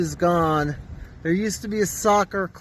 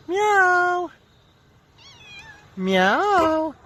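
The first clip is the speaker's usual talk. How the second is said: mock meow; 14 semitones higher than usual. Meowing at the cat.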